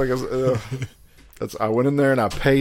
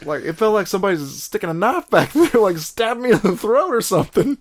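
rising pained excitement; 12 semitones higher, 7 dB louder than usual. Real, not acted. Describing the burning pain.

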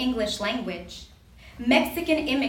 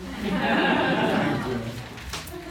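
nervous laugh; 9 semitones lower than usual. Real, not acted. Nervous poet before reading.

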